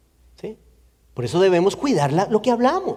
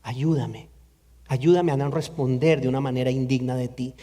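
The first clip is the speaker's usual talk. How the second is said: soft prayer; 6 semitones lower, 5 dB quieter than usual. Retold or imitated. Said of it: "la actitud del corazón".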